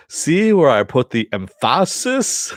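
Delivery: high, loud, slow mock actor voice; quoting Clayface. Podcaster imitating Clayface coaching Riddler, hammy. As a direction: lean on every word like a pompous actor. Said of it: "Clayface with the".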